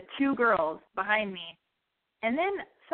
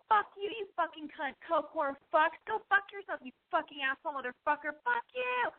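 mock furious cursing; 7 semitones higher than usual. Retold cursing back at car.

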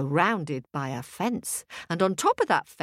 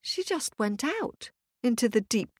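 ordinary delivery

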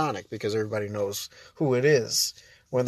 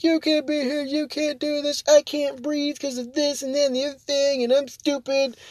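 much higher, shrill-edged entitled demands; mock Karen rant; 11 semitones higher than usual. Vlogger imitating a screaming Karen, high mock whine. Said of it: "and they're like".

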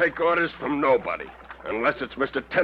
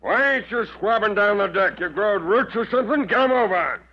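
captain barking orders at his mate; bawling out; 6 semitones higher than usual. Acted. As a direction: chew out a lazy deckhand.

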